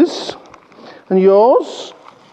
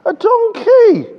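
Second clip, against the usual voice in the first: high, loud, slow surprised donkey; surprise reveal; 5 dB louder, slower than usual. Acted. Punchline of king on a donkey.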